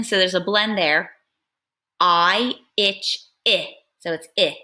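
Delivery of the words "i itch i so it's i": plain reading voice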